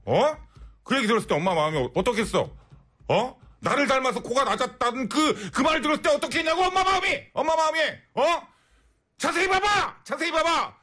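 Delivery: high-pitched scolding mother rant, shouting; scolding mom. Pretend. Mother scolding her daughter over nose surgery, shrill yelling. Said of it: "엄마 입장에서".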